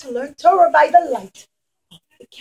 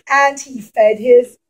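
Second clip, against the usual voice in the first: ordinary delivery, nothing marked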